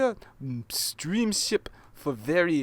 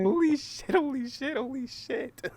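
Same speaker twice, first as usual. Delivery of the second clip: shocked; 8 semitones higher, 4 dB louder than usual. Real, not acted. Shocked exclamation.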